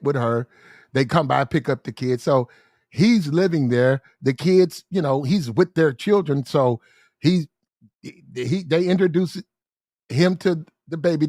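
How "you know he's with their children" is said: ordinary talk, nothing marked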